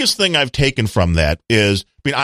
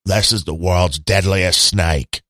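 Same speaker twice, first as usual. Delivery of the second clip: lightly put-on Australian accent, lower and slow; 7 semitones lower, slower than usual. Mock Steve Irwin presenting a snake to podcast listeners, in a weak Australian accent. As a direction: play Steve Irwin with a loose, half-hearted Australian accent, drawn out. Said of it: "gotta do the Australian accent".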